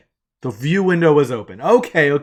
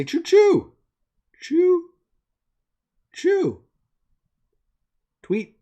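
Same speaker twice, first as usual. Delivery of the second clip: playful, very high falsetto chirps; high-pitched chirping; much slower than usual. Pretend. Streamer voicing the tweet being typed, high playful chirp. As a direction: chirp each word out high, with gaps between.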